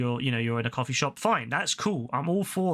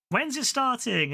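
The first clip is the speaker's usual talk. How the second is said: higher, drawn-out imitation of an excited child; excited kid; 10 semitones higher, slower than usual. Kid shouting out during Dragon Ball film, excited imitation. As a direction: imitate a kid eagerly calling out, pitched up. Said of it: "it was just an excited kid".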